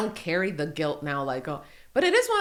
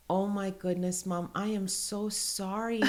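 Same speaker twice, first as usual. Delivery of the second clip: soft, slow, imitated remorse; tearful child's apology; 9 dB quieter, slower than usual. Son apologizes to mom for accusing her, softly near tears. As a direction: imitate a little boy close to crying. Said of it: "looked like he was gonna cry".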